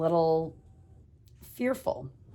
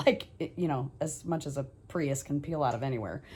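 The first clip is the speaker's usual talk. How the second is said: ordinary delivery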